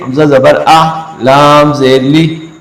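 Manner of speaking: slow, sung letter-by-letter reading of "लाम जेर ली"; spelled-out Qur'an recitation. Shown as a demonstration. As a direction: chant each letter and its vowel. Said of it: "ऐसे देखिए". (धीमे सुर में हिज्जे करके पढ़ना)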